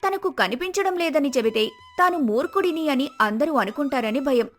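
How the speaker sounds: ordinary delivery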